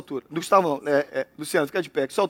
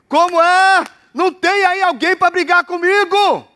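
higher, louder, slow jeering challenge; loud mocking taunt; 7 semitones higher, 4 dB louder, slower than usual. Goliath daring Israel on the fortieth day, loud jeering taunt. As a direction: bellow the dare at the cowering army.

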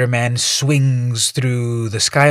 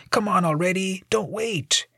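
mocking heckler egging him on; heckler yelling; 10 semitones higher, 4 dB quieter than usual. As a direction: taunt the jumper from the street. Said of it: "and someone yells".

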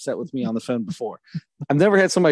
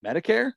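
MEDICARE pitched high, rising as a question; just echo MEDICARE with a high questioning lift; questioning repeat; 8 semitones higher than usual. Retold or imitated. Man retelling how he repeated the caller, questioning repeat. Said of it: "all I did was say".

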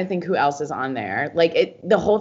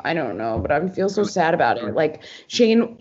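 normal talking voice

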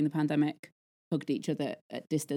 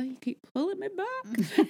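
a light Godfather impression, lifted high; Godfather quote; 12 semitones higher than usual. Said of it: "a little bit like The Godfather".

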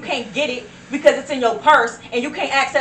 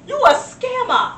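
higher, slower accusation; accusing scammer; 10 semitones higher, 5 dB louder, slower than usual. Acted. Accusing a phone scammer, slow and high. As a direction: call out the scammer.

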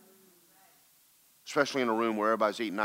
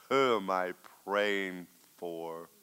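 slow, drawn-out retold question; awed question; 2 semitones lower, much slower than usual. Preacher asking God whom the prayer is for, slowly. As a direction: stretch each word in wonder. Asks God amid weeping intercession.

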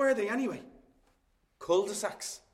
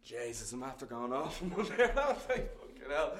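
laughing; 2 semitones lower, 4 dB quieter than usual. Acted. Laughs at his own rant.

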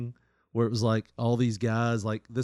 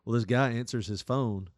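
normal talking voice

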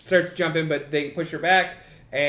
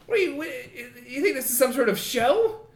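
much higher, indignant snap; a boss losing it; high angry boss; 8 semitones higher than usual. Acted. Boss shoving Maxwell into battle, high and indignant. Craig furious then pushes.

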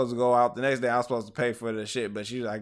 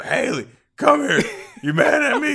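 put-on higher, louder sloppy drunk voice; drunk friend imitation; 14 semitones higher, 9 dB louder than usual. Imitating a drunk friend calling to Haley, high and loud. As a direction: ham up the pleading drunk buddy.

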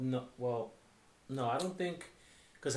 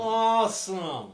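very slow, higher, louder awesome; mock impression; 7 semitones higher, 5 dB louder, much slower than usual. Friend copies clip, slow high drawn-out awesome. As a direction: draw the word out like a cartoon. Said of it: "how many impersonations I can kinda do".

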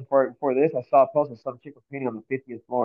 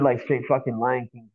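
ordinary delivery

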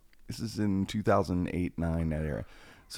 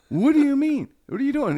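much higher and louder, near a cry of amazement; can't believe a stranger came to help; amazed disbelief; 6 dB louder than usual. Retold or imitated. A stranger shows up to search, he answers in astonishment. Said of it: "I go".

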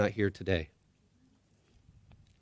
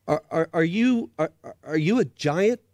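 higher, stuttering and scared; Shasta, stammering in fear; 7 semitones higher, 4 dB louder than usual. Acted. Boy asks the unseen creature if it's a giant, stammering. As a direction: stammer it out, terrified. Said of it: "asked Shasta".

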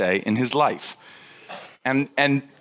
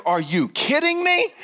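incredulous; 10 semitones higher than usual. Retold or imitated. Acted quote of desperate friend.

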